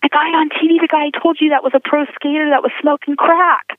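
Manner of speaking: high-pitched, insistent retold explanation; excited insistence. Woman reminding boyfriend of the skater from TV, excited.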